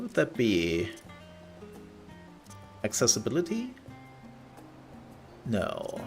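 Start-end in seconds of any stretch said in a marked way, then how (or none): none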